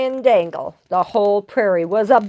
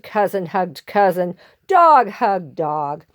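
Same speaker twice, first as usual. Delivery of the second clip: ordinary delivery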